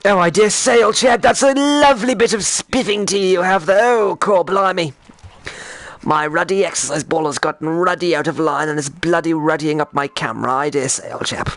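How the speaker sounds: hammed-up British toff; over-the-top British accent, lilting high at first.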